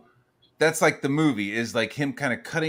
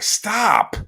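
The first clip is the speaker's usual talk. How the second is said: imitating wife; 9 semitones higher, 4 dB louder than usual. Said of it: "My wife is like".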